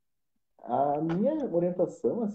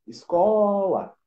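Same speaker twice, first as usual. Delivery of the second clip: "Escola" called out higher and louder, CO held long; stress on CO; 6 semitones higher, 5 dB louder, much slower than usual. Shown as a demonstration. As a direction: stretch the CO in "Escola", slow and strong. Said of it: "eu tenho có". ("Escola" chamada alto, com CO bem alongado)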